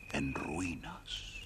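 whispered, slow and eerie; storyteller's eerie whisper; spooky whisper. A narrator's reading. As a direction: hush the words to build suspense. Narrator sets spooky scene.

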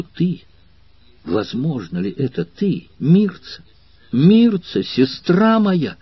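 a brother who cannot believe he has found his sister; overwhelmed disbelief. A narrator's reading. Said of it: "с невыразимым волнением".

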